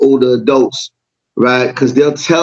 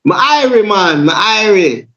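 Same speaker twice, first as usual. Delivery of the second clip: mock Jamaican accent; 7 semitones higher than usual. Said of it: "He goes".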